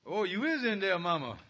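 ordinary talk, nothing marked

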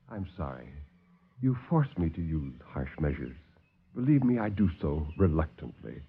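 low, soft, smooth villain; calm the visitors like a silky host; suave doctor. Acted. Doctor soothes the alarmed officers, low and soft.

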